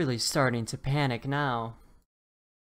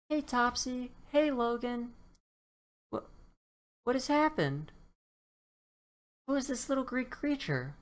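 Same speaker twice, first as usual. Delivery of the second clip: put-on high, bright girlish dino voice; a cheerful friend turned puzzled; Violet voice; 11 semitones higher than usual. Violet greets friends and finds a leprechaun, high voice.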